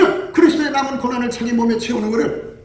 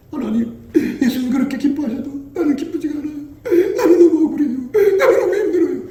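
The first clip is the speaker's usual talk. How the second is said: whiny, louder, higher protest to God; complaining believer; 6 semitones higher, 5 dB louder than usual. Pretend. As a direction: play a sulky believer, half laughing. (하나님께 억울하다고 투정하는 신자 흉내)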